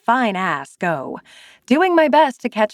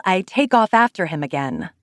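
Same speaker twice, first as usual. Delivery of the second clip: ordinary delivery, nothing marked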